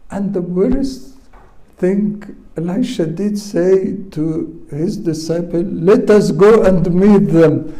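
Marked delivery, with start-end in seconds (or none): 5.6-7.7 loud, confident rallying call; acting out a fearless prophet, much louder; bold urging. Elisha tells his disciple to face the armies, loud and bold. Prophet boldly proposes meeting enemy.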